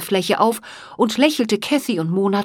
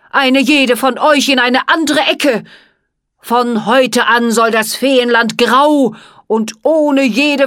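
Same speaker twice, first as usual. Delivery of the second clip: loud, high, slow menacing curse; furious curse; 7 semitones higher, 9 dB louder than usual. Acted. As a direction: bellow the curse, slowing down. Villain casts curse.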